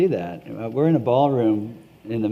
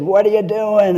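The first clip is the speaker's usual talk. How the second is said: put-on higher, louder Maine accent; 9 semitones higher, 7 dB louder than usual. Trump voter to signature collector at the market, Maine accent. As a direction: imitate a Maine local, pitched up and loud. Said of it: "not making fun of that accent".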